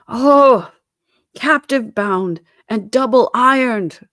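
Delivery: high, loud wailing cry; anguished cry. A narrator's reading. Phantom cries out in anguish, high and loud. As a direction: cry out in torment. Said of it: "cried the phantom".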